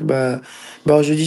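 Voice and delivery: ordinary talk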